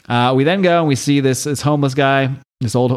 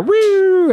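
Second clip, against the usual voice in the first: high-pitched "woo" siren noise; imitated siren wail; 3 dB louder than usual. Reader imitates approaching cop sirens, high wail. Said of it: "As we hear the sirens".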